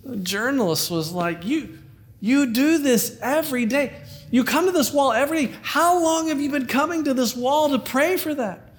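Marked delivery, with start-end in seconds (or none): 1.4-8.7 amazed. Acted. Astonished journalist in joke.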